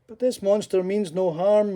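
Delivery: a mild pleading tone. Acted. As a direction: beg them to listen. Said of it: "Mackenzie pleaded".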